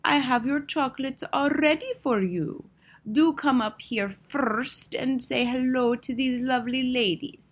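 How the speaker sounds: gentle, honeyed witch in a put-on voice; syrupy cooing witch. A narrator's reading. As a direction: lure a child with dripping sweetness. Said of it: "soft and gentle and absolutely dripping with syrup".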